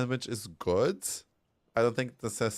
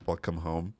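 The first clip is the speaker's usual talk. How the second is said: put-on a light Swedish accent, lower, laughing; 5 semitones lower, 4 dB quieter than usual. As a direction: say it like a Swedish fan greeting. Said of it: "speaking in a, in a Swedish accent".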